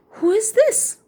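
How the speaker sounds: high, wondering gasp; gasp, then ask in wonder; gasping surprise. Acted. Storyteller surprised at the hiding man.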